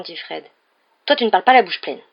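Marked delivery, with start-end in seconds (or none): 1.0-2.0 loud, higher, dry rebuke; curt retort. A narrator's reading. Mother silencing Fred at breakfast, curt retort. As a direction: cut him off sharply. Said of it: "répliqua sèchement madame Weasley".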